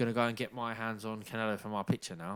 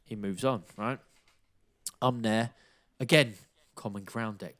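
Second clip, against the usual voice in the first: normal talking voice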